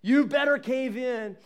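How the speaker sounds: loud, high barked command; barking threat. Acted. Pressure barks at you with a loud threat. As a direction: bark it out like a bully. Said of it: "bark at you".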